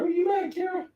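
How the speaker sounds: very high, soft coaxing voice; baby talk to a pet. Acted. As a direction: coo it to the dog like a baby. Teasing the dog in baby talk.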